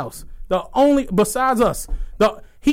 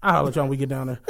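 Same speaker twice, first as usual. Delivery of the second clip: lower-voiced casual brag, lightly done; imitating Marty; 9 semitones lower, 3 dB quieter, faster than usual. Friend Marty announcing his Ferrari rental, low mock voice. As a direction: drop the voice low and boast offhandedly about the Ferrari. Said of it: "Marty came in the room and was like".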